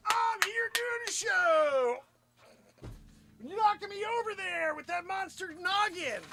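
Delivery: high, strained scolding; high-pitched fed-up protest; exasperated outburst. Real, not acted. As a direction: shout at misbehaving dogs in a high, stretched-out whine. Yelling at dogs disrupting show.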